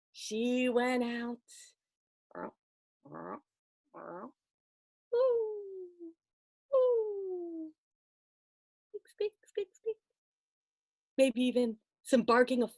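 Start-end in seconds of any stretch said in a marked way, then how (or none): 2.3-7.7 high soft owl hooting. Acted. Storyteller imitating night owl, soft hoots. 8.9-10.0 tiny high squeaking; mouse squeaks. Acted. Storyteller imitating night mice, high squeaks.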